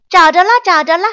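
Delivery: high, loud cry of discovery; excited shout. A narrator's reading. Monkey cries out a fake find, high and loud. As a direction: call out as if you found it. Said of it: "忽然他喊叫起来".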